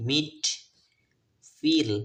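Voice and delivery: "মিট", "ফিল" loud and separate with ee. Shown as a demonstration. Teacher models words for learners, "মিট" and "ফিল" emphatically with ee. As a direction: punch out "মিট" and "ফিল", vowel as ee. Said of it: "ই এর উচ্চারণ হয়েছে ই এর মতো".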